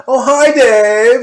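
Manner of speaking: high, slow, loud campy "Oh, hi, Dave"; camp greeting. Retold or imitated.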